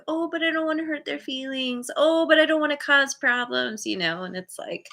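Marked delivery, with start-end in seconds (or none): 0.1-3.9 higher pitched, worried people-pleaser; imitating your own anxious excuses, pitched up; lightly hammed-up fretful whine; mock fretting. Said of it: "because I'm like".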